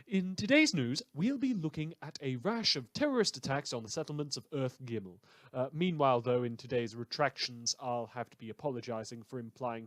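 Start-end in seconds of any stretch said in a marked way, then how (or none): none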